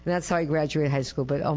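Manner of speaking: usual speaking voice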